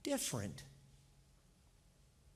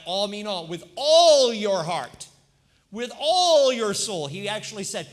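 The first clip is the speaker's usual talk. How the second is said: full-force preaching; 4 semitones higher, 10 dB louder than usual. Acted. Pastor preaching to the congregation, full-force pulpit voice. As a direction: drive it home from the pulpit.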